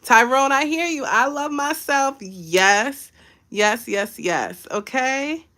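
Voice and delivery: ordinary delivery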